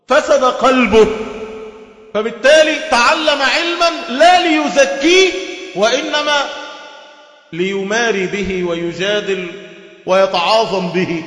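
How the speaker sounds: high, slow, forceful sermon delivery; preacher hammering the point; slow emphatic preaching. Acted. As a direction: drive each phrase home, slow and high. Preaching climax.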